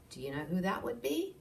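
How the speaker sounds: ordinary delivery, nothing marked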